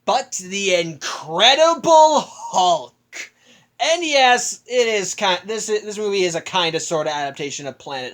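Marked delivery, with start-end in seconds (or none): 0.0-2.9 dramatic reveal. Retold or imitated.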